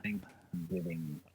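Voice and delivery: breathy whispered Thanksgiving. Real, not acted. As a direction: whisper it slowly. Whispered aside on the holiday.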